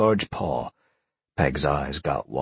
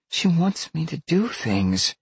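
put-on high-pitched girl's complaint; distressed girl; 13 semitones higher than usual. A narrator's reading. Upset girl complaining about her beast.

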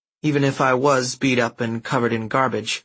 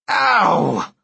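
loud pained ow; pained yelp; 8 semitones higher, 5 dB louder than usual. Acted. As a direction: cry out as you hit the ground. Thug slips and falls hard.

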